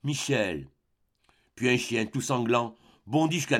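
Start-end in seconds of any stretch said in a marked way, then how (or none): 0.0-0.7 mildly plaintive call of a name; a touch of a moan, understated; plaintive murmur. A narrator's reading. Faint voice of a friend calling Michel, plaintive murmur. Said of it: "murmura d'un ton plaintif".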